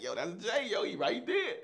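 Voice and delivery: high, laughing awe; whisper-shout of disbelief; starstruck. Retold or imitated. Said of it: "I was like".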